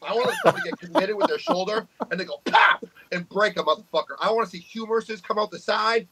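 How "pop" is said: a loud, sharp popping sound; imitated pop. Describing a shoulder breaking in a match, as a loud mock pop. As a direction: mimic a shoulder popping out of its socket.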